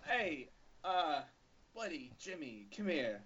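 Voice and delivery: hushed, halting Walken-style aside; a loose take on Christopher Walken; Christopher Walken impression. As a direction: lean in like Walken to break the news. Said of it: "Christopher Walken impression".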